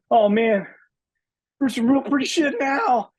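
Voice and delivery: high, loud panicky-soldier impression; Bill Paxton as Hudson. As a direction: wail it in a high, hammed-up movie-marine voice. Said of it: "but Paxton, uh, be able to just".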